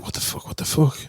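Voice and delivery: hushed, breathless what-the-hell; whispered disbelief. Retold or imitated. Hungover neighbour woken by screaming, whispering in disbelief. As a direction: whisper it in stunned confusion.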